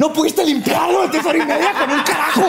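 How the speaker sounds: high, exasperated scolding; mock angry scolding. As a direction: scold with comic fury. Killer scolding maid. (regaño enojado exagerado)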